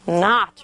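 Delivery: "Not" with an open ah vowel; short O sound. Shown as a demonstration. Teacher confirming word before adding E, short O sound. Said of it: "It's saying the ah sound".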